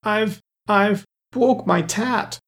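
slow, high-pitched toddler wail, lightly acted; halting, babyish tearful lisp; sobbing little girl. A narrator's reading. Mary tells Claus she broke her toy, sobbing. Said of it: "sobbed Mary".

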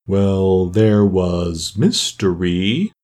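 very slow, long-drawn vowels; doleful turtle. Acted. Mock Turtle lists his lessons for Alice, slow and doleful. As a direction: stretch each subject out ponderously.

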